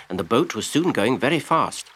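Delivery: ordinary delivery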